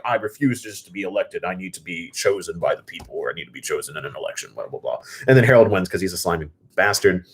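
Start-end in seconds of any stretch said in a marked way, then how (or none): 0.0-5.0 mumbled, dismissive imitation, much softer; mock him with a droning voice that trails off; mocking King Bjorn. Vlogger mocks King Bjorn's speech, mumbled and dismissive. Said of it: "and King Bjorn's like".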